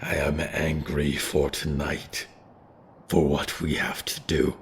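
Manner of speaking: a calm ghostly elder owning his anger; ancient spirit, solemn. Acted. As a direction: speak from centuries of grief, unhurried. Diggory voiced as ancient creature.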